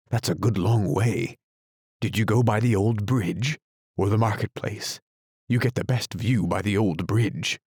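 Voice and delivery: plain reading voice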